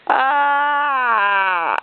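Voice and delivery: ordinary delivery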